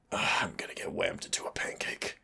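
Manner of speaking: soft, breathy whisper to himself; whispered aside. Acted. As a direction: mutter the dread under your breath. Dreading the warhammer, aside.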